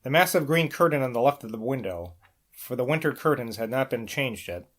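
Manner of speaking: ordinary delivery, nothing marked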